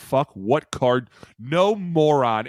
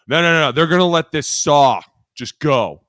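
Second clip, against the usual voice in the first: emphatic mock protest; 5 semitones higher, 11 dB louder than usual. Player loudly insisting to coach the blocker goes free. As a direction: lean in hard on no.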